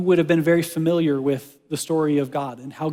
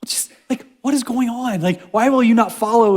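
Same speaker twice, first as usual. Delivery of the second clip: exasperated leader; 6 semitones higher, 3 dB louder than usual. Pretend. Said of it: "you're like".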